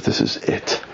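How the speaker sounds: whispered resolve; under his breath. Acted. The king to himself, whispered resolve. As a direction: whisper it, grimly decided.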